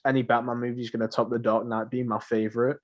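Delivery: usual speaking voice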